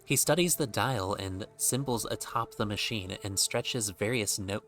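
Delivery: ordinary talk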